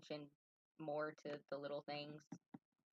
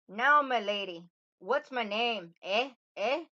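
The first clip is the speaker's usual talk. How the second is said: loud, higher, taunting little man with an attempted Irish accent; Rumpelstiltskin, gleeful; 7 semitones higher, 15 dB louder, slower than usual. Little man quizzes the queen on his name, gleeful taunt. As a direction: goad her gleefully, raised and loud. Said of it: "hopping with glee and saying".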